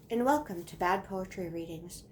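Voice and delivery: normal talking voice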